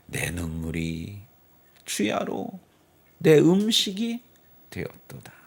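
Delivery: usual speaking voice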